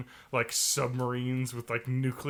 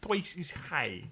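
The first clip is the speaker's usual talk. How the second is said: higher, slow mock Russian accent through laughter; 4 semitones higher than usual. Friend doing the high-voiced Russian accent. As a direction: scold it like a comic Soviet teacher. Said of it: "the high voice Russian".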